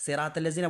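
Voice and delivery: সেরাতাল্লাযিনা run together as "seratellazina"; wrong, thin-lettered সেরাতাল্লাযিনা; common mispronunciation. Shown as a demonstration. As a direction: say সেরাতাল্লাযিনা with light s and t. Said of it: "ninety percent মানুষ".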